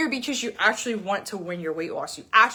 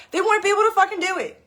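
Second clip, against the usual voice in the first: higher, louder and fast, words tumbling out; exasperated outburst; 9 semitones higher, 4 dB louder, much faster than usual. Real, not acted. Coach ranting to followers about diet-company staff, exasperated high fast outburst. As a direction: blurt it out in disbelief.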